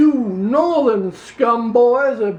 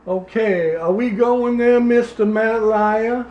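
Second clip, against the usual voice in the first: put-on Louisiana accent. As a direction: play a pompous Louisiana candidate, Southern accent. S0 plays Louisiana politician throughout.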